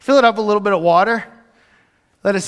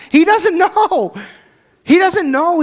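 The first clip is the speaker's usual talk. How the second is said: much higher, louder, slow cry; anguished outburst; 10 semitones higher, 4 dB louder, slower than usual. Real, not acted. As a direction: cry out the worry. Anguished exclamation.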